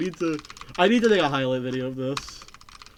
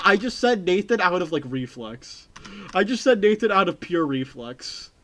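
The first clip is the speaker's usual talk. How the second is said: ordinary talk, nothing marked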